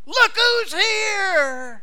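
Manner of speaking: very high, loud and very slow, stretching each word; ecstatic drawn-out cry. Acted. New believer greeting the Savior, drawn-out ecstatic shout. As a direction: cry it out long, in full delight.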